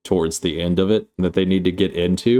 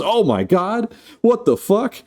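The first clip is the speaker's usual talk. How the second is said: high-pitched acted panic; hammed-up horrified squeal; mock horror; 13 semitones higher than usual. Acting horror at melted finger.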